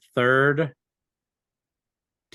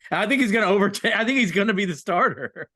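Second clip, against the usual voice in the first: laughing; 7 semitones higher, 4 dB louder than usual. Real, not acted. Laughing while hyping Eubanks.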